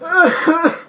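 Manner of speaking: forced fake cough. Kid faking a cough for mom, fake cough. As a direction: cough to fake being sick.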